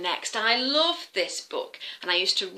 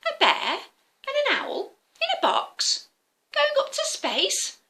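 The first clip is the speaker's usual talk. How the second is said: put-on much higher, astonished child with a laugh; amazed little boy. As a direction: marvel at the sight.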